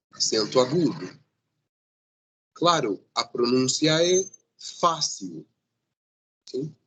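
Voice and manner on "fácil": "fácil" stressed on FÁ, weak ending; strong FÁ, then let -cil drop in "fácil"; stress on penultimate syllable. Shown as a demonstration. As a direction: model "fácil" as FAH-seew. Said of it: "a sílaba forte é a penúltima sílaba".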